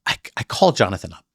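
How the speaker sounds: ordinary delivery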